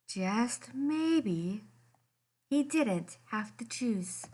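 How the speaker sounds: plain reading voice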